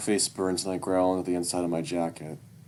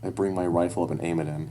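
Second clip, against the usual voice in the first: usual speaking voice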